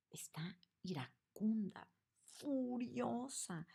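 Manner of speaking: slow, high, rising with rage; building fury. Shown as a demonstration. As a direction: let the anger climb word by word. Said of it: "veamos cómo va subiendo de tono".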